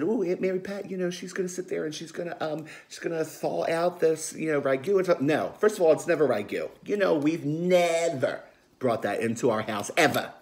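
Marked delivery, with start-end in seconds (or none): 0.0-5.2 high, sing-song mock of the neighbour gossiping. Aunt mimicking nosy neighbour Maria gossiping to everybody, high mocking voice. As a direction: sneer it up high like the nosy neighbour spreading news. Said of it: "she probably called everybody up and said".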